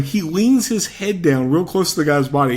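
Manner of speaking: normal talking voice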